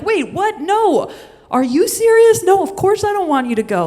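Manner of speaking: very high, loud disbelief; shocked. Retold or imitated. Boyfriend stunned that she wants to leave, very high and loud. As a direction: squeak out startled alarm. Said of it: "And he's like".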